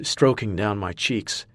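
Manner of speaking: ordinary delivery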